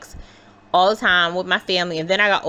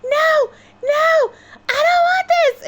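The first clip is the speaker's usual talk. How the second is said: high, crying, screaming no; retold scream of a crying child; terrified screaming; 4 dB louder, slower than usual. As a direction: wail it like a terrified kid on a ride. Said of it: "screaming my heart out like".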